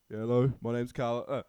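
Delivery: loud attempt at the co-host's British accent; hammed-up British accent impression of co-host; imitating co-host's accent. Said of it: "Okay, I'll do the damn accent".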